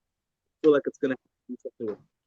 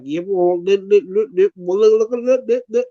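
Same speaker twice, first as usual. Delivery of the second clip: rising, louder nonsense babble; gibberish; 4 semitones higher, 4 dB louder than usual. Retold or imitated. Retelling speech they can't make out, imitated gibberish. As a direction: imitate someone's talk turned to meaningless syllables. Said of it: "but it's gibberish".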